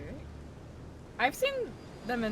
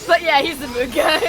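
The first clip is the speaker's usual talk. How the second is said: louder, higher laughing mimicry; laughing echo; 7 semitones higher, 5 dB louder than usual. Real, not acted. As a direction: repeat it back while cracking up.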